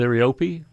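liriope said as leer-ee-OH-pee; liriope, last e sounded as ee; other people's pronunciation. Shown as a demonstration. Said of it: "Other people call it".

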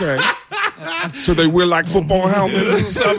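high squeaky Tracy Morgan impression with laughter. Tracy Morgan asks a silly question, high mock voice. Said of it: "Tracy Morgan saying to me".

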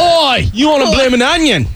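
high, hammed-up Australian holler; mock Aussie challenge; Australian accent. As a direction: call out in broad cartoon Aussie. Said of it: "See, that's perfect".

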